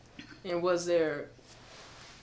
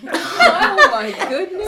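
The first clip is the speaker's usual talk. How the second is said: laughing reaction; active listening; 14 semitones higher, 10 dB louder, much slower than usual. Real, not acted. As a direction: laugh along in surprise. Listener reacts to fight story.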